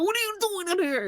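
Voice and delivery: squeaky high-pitched mock voice; high put-on voice. Retelling what the streamer expected, high silly voice.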